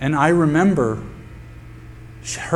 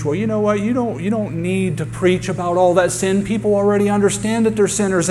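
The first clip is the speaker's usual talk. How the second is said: a hint of a breezy preacher, pitched up; mock pastor; 6 semitones higher than usual. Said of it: "I've heard pastors preach".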